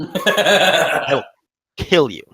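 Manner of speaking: lightly put-on southern accent. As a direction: threaten with a mock southern twang. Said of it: "talks in her southern accent".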